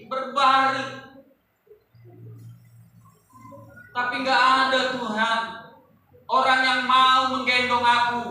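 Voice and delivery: loud pleading lament to God; complaining cripple. Acted. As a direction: plead loudly as the paralysed man. Lumpuh man's complaint voiced. (keluhan orang lumpuh yang memelas)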